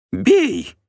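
very high, loud squeal; squealing yell. A narrator's reading. Steward daring them to beat him, squealing yell. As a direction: yelp it out in shrill defiance. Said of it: "визгливо закричал Тиун".

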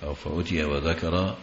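"فأتي وذكر" as fa-utiya wa-dhakara; two fathas. Shown as a demonstration. Teacher gives the alternative "فأتي وذكر", fa-utiya wa-dhakara. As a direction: passive "فأتي", then "وذكر" with all a vowels. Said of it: "بفتحتين".

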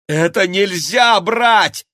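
high, loud alarmed cry; frightened exclaim. A narrator's reading. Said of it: "испуганно воскликнул он".

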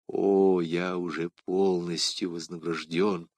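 plain reading voice

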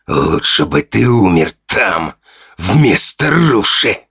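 slow, drawn-out, loathing words; bitter parting curse. A narrator's reading. Father bends to son's face, slow and bitter. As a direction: say it slowly, right into his face, bitterly.

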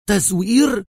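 high-pitched burst of amazement; astonished exclamation. A narrator's reading. Consul hearing shocking news from Mona, exclaiming in astonishment. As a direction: exclaim it in utter disbelief. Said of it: "في دهشة بالغة وهو يهتف".

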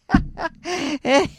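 high giggle; high laugh. Real, not acted. Laughing at own joke.

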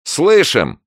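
high, loud shout back; defiant yell. A narrator's reading. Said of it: "крикнула она даже громче, чем следовало бы". (вызывающий громкий крик)

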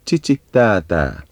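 high chirpy imitation of a willow tit call; imitated tit call. Shown as a demonstration. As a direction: chirp it out like the little bird. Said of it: "Juuri tämä ääni".

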